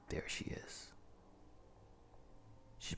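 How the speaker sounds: breathy whisper, spotting his prey. Acted. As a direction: hush it like a predator watching. Stalker spots his target.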